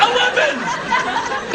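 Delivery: drawn-out cry. Real, not acted. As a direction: cry eleven out slowly at the stubborn lift. Yelling at the lift.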